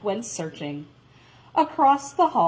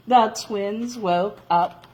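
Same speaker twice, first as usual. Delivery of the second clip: ordinary delivery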